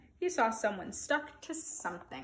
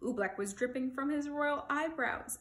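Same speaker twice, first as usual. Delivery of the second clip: ordinary delivery, nothing marked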